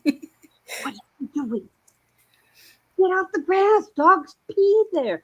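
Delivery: high mock scold from annoyed neighbors; mocking the smokers. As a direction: lightly imitate a nagging onlooker. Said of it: "all these smokers are like".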